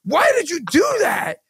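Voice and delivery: high-pitched retold yell of protest; mock outraged shout. Retold protest right after screaming.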